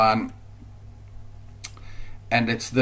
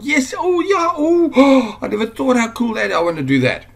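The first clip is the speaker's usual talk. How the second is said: high, gasping, thrilled squeal; excited discovery; 12 semitones higher, 6 dB louder than usual. Pretend. Viewer imagining the videos, gasping with high excitement. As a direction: gush like you just found the perfect idea.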